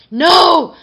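high, very loud scream of shock; shocked plea. A narrator's reading. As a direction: cry out to stop it, full force. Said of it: "a shocked Tooth Fairy pleaded".

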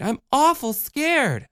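put-on high, slow, mildly scared child; girl confessing she is scared; scared little girl. A narrator's reading. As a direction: admit fear like a small girl. Michelle admits fear.

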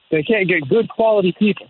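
ordinary talk

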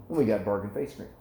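ordinary talk, nothing marked

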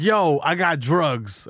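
very slow mock drug offer; drawn-out imitation. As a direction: stretch each word as a comic quote. Imitates stranger's message offering drugs.

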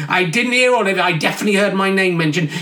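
ordinary talk, nothing marked

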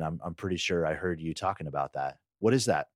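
ordinary talk

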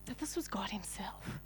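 a soft whisper; whispered reverence. Real, not acted. Preacher revealing who Jesus is, whispered. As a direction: hush it reverently.

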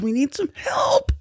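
very high, drawn-out cry for help; mock plea for help. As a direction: squeak out a slow, playful plea. Acting out a radioed plea.

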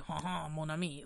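quiet mock French accent. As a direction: purr it like a cartoon Frenchman. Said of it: "Sometimes I think French go like".

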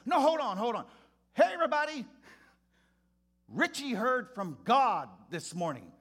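high, loud announcement to the whole room; rally everybody in, booming and excited; pastor calling out. Retold or imitated.

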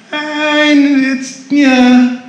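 high-pitched evasive waffle, imitated; noncommittal hedging. Clinic worker dodging a blood pressure question, noncommittal. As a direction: mimic someone squirming out of a straight answer. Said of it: "That's what he said".

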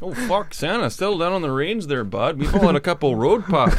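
Canadian reindeer calming Santa; put-on Canadian accent. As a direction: protest in a folksy Canadian accent. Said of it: "very Canadian accent?".

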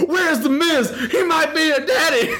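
high laughing mock yell; laughing hammed-up backstage yell; mock frantic yelling. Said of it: "screaming this backstage".

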